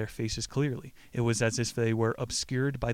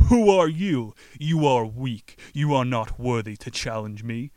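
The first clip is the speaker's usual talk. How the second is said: scornful demon; 7 dB louder than usual. A narrator's reading. Demon taunts the young man, loud theatrical scorn. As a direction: boom it out, belittling the challenger.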